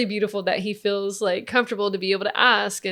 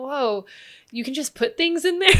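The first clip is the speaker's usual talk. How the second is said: put-on amazed child; 6 semitones higher than usual. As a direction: marvel like a little boy discovering something. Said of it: "You know?".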